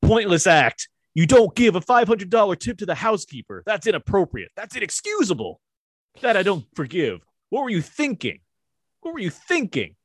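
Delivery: high-pitched, exasperated telling-off; acted indignant scolding. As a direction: scold them like a scandalized boss. Scolding movie quote, acted.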